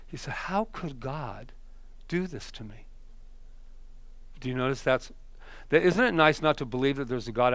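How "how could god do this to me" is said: tearful plea. Retold or imitated. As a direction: mildly voice a despairing man. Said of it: "and he's crying".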